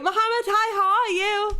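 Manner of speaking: high, bright hello; excited greeting. Real, not acted. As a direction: greet them with delight. Greeting a viewer on stream.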